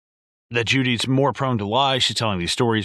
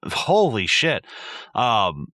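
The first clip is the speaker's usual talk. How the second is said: ordinary talk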